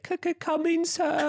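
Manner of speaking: high, stuttered eager reply; forced servile cadence. Acted. Bet loser answering the catchphrase on demand, high servile stutter. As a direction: stammer it out high like a flustered servant. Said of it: "in that fucking cadence and accent".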